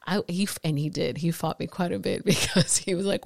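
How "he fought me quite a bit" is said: usual speaking voice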